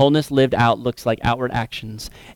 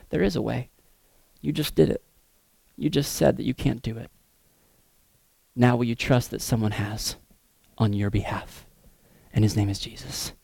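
softer, lower, quietly reassuring; gentle reassurance; 3 semitones lower, 6 dB quieter than usual. Acted. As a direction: offer hope gently, almost hushed. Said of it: "And they go".

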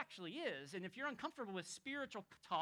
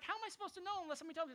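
quick, higher rushed answer; rapid reply; 10 semitones higher, much faster than usual. Retold or imitated. Ethiopian answering Philip, fast and higher. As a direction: rattle it off fast and high. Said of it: "He's like".

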